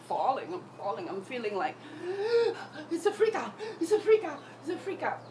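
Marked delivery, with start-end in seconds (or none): 1.8-2.8 sharp panicked gasp; high startled gasp. Retold or imitated. Panic attack described. 2.9-5.2 high, urgent panic; high, louder freaking-out voice; panicky. Retold or imitated. Voicing the panicking mind.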